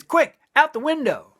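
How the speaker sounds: high squawky parrot call. Acted. Parrot warning the lover to flee, high squawk.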